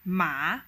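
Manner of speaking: "ma" pressed down low, then bounced up; third tone. Shown as a demonstration. Teacher demonstrating the hardest tone, "ma" dipping then rising. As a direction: dip deep on "ma" and rise out of it. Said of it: "The third tone will sound something like".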